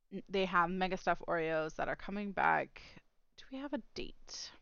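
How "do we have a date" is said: whispering. Real, not acted. Quick aside under her breath.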